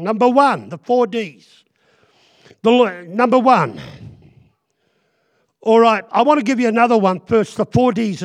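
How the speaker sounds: loud full-force sermon; preaching. Acted. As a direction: drive it home to the congregation.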